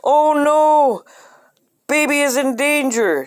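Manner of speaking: high, loud cry of alarm. Acted. Sudden alarm over dangling baby.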